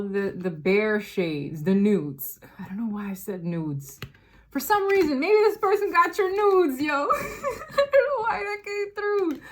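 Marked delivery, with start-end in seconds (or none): 4.5-9.4 high, loud laugh breaking into a sob; laughing, near tears. Real, not acted. Reader laughing and tearing up at an intuitive hit.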